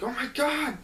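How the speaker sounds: high, loud mock cry of shock; acted-out alarm. Onlookers as the kids keep falling, alarmed cry. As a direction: exclaim in imitation panic.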